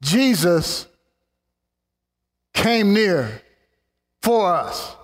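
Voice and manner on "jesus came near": normal talking voice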